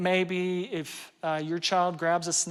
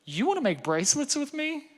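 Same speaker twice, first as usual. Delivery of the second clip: higher, amazed echo of the offer; surprised delight; 8 semitones higher than usual. Retold or imitated. Daughter surprised dad wants to make bracelets, higher amazed. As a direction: daughter can't believe it, pleasantly surprised. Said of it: "And she's like".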